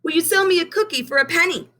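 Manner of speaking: put-on high, eager little boy voice; young Benny asking. A narrator's reading. Benny asks Miss Hill for a rose, high and eager. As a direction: ask sweetly like a small boy. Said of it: "asked Benny".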